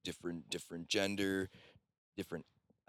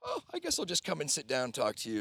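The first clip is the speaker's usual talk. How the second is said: quick, high, louder Australian impression; imitated Australian accent; 7 semitones higher, 5 dB louder, faster than usual. Retelling how she sat down to talk, imitated Australian accent. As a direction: breeze in as a chatty Australian, pitched up. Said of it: "with this Australian accent".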